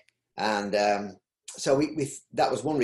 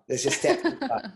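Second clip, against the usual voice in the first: laughing; 11 semitones higher, 4 dB louder than usual. Real, not acted. Jokey refusal of doctor title.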